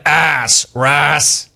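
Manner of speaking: "ass, Rass" said with the same short a; rhymed name pronunciation. Shown as a demonstration. Host teasing Rass about his name, rhyming "Rass" with "ass". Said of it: "you know why I pronounce your name like that".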